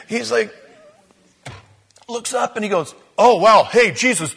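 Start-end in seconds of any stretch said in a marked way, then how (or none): 3.2-4.3 excited. Retold or imitated. Peter waking on the mountaintop.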